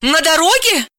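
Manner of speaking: high, incredulous snap; losing her temper. Acted. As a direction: throw it back in outraged disbelief. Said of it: "психанула Далила".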